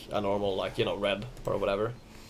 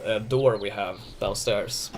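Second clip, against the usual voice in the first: normal talking voice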